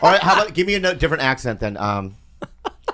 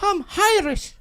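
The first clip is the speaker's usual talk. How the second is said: very high Irish lilt; Irish accent; 4 dB quieter, slower than usual. Shown as a demonstration. Asked again, he gives a high Irish accent. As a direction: squeak it out like an Irish caricature. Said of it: "Oh, do it again".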